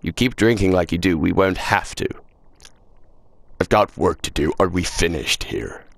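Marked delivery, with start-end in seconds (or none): none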